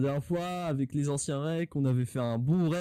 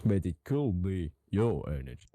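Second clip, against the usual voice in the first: deep slow voice; 9 semitones lower than usual. Pretend.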